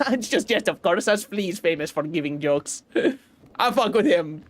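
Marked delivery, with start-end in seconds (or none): 0.0-2.8 a friendly flea teasing the stranger; fake European accent. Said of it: "if you thought that accent was racist".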